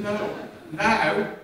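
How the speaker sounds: booming correction from the pulpit; emphatic insistence. Retold or imitated. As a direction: drive it home with force. Jesus insisting on now.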